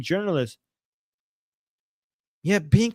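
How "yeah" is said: ordinary talk, nothing marked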